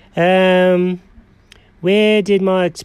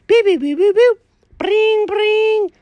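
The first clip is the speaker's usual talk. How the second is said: high mouth beeps of keys pressed; phone dial beeps; 13 semitones higher, slower than usual. Acted. Cat dialing Alfred, high keypad boops. Mimics dialing and ringing again.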